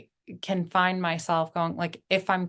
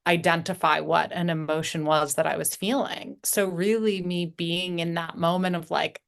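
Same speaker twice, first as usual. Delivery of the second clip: ordinary talk, nothing marked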